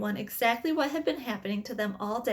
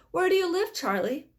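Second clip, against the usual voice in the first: bright, high, eager ask; excited, rising question; 7 semitones higher, 4 dB louder than usual. A narrator's reading.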